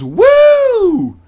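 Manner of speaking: very high, loud party whoop; drunken woo. Acted. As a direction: whoop it up from the bar stool. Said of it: "and yells".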